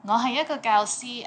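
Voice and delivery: normal talking voice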